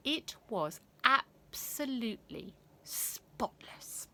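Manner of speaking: slow awed whisper. A narrator's reading. Narrator hushing the young audience. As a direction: breathe it like a secret.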